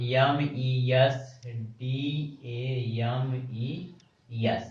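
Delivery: slow spelling, each letter apart; spelled out letter by letter. Shown as a demonstration. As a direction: spell M-E-S-D-A-M-E-S out slowly. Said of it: "अगर इसकी pronunciation की बात करें". (धीरे-धीरे अक्षर बोलना)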